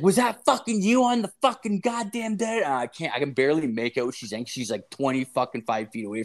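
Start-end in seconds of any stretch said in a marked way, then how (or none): 0.0-2.6 high-pitched imitation of a yell; mock yelling. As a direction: mimic someone yelling garbled abuse from afar. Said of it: "starts yelling shit at me".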